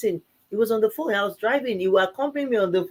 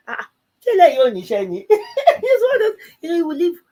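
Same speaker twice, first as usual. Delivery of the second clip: higher, slow words broken by laughter; laughing; 10 semitones higher, 6 dB louder, slower than usual. Real, not acted. Wife laughing about her husband's devotion, high and slow. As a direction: laugh through it in delight. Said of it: "laughing about her husband".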